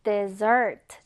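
dessert with the stress on the back syllable, "-ssert"; stress on second syllable. Shown as a demonstration. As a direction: lean on the end of "dessert". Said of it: "강세가 요 뒤에 붙어요".

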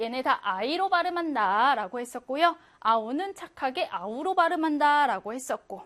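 ordinary delivery

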